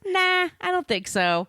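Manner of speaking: high, breezy voiced brush-off; mock dismissive refusal. Host voicing Eleanor rejecting Dickens, sassy and high. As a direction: toss it off like a sassy put-down. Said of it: "She says".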